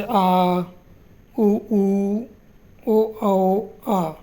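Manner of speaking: आ उ ऊ ओ औ said slowly, one by one, each held clearly; vowel demo. Shown as a demonstration. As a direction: sound out आ, उ, ऊ, ओ, औ as separate model vowels. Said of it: "जैसे".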